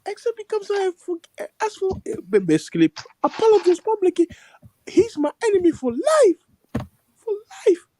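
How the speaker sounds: high-pitched mock tearful tirade; crying man. Retelling how he declared his enemy for life, mock crying. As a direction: imitate swearing revenge through sobs.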